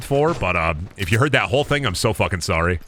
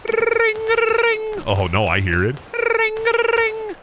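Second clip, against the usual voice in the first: very high sung ring tone; phone ring imitation; much slower than usual. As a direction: sing it like a ringing phone. Mimicking a phone ringer.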